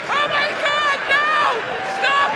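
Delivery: high shrieking fan voice; screaming fan girl. Retold or imitated. Fans screaming at drunk star.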